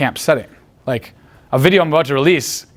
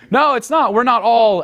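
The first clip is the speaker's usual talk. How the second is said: imitating the girl; 8 semitones higher, slower than usual. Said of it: "she's gonna go".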